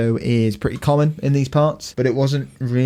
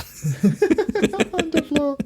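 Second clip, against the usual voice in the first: squeaky high; much slower than usual. Pretend. Joking about snack bar seating.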